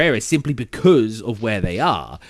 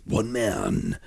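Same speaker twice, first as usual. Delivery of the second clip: heavier character voice; 2 semitones lower, 6 dB quieter than usual. Shown as a demonstration. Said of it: "sort of different".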